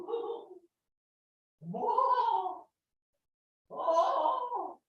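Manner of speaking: high drawn-out "whoa"; mock wailing. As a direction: exaggerate a high-pitched moan. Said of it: "but not that, you know, not like that".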